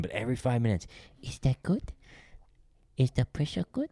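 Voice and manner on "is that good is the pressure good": high imitated Japanese accent, earnest questions; mock Japanese accent, pitched up and rising; little Japanese voice. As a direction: check in like a genuine, sweet Japanese masseuse. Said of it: "she was just being real genuine".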